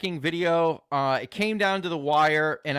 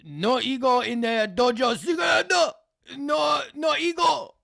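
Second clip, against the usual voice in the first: high shouted dojo master; mock master shout; 8 semitones higher than usual. A streamer hamming up a chat motto, shouted high. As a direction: bark the motto like a martial arts master.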